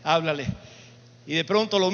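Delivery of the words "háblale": lightly voiced low, insistent order; a hint of a lower, commanding voice; voice of the Lord. The Lord urging the priest to speak, lightly lowered and insistent. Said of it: "el Señor que me decía".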